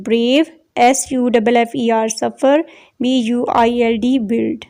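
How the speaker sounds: letters spelt one by one, then the word said whole; teacher spells the missing-letter words for the class; spelling out. Shown as a demonstration. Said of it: "first वाले में H use".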